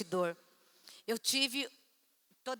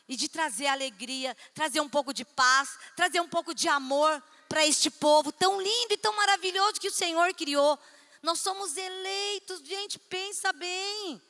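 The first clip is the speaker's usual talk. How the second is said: louder, rising preaching build; preaching climax; 3 semitones higher, 5 dB louder than usual. Acted. Pastora preaching to the congregation, building in fervor. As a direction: lift the church with rising fervor.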